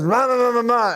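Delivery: high, mumbled ma sounds; a light imitation of mangled yelling; garbled old man. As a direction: make it unintelligible like the old hiker. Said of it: "And he goes".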